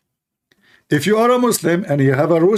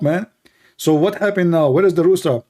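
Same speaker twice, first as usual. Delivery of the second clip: normal talking voice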